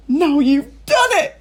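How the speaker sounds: high, loud, slow angry outburst; angry cry. A narrator's reading. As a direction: scold him, voice up high and loud. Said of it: "Missus Cranky cried, glaring at her husband".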